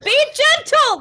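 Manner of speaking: screamed high and loud; panicked shout. Acted. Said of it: "He shouts".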